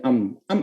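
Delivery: "am" run together in place of "I am"; contracted, quick "am". Shown as a demonstration. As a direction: say "am" as one short syllable. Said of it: "bacanya lebih cepat tuh pakai am".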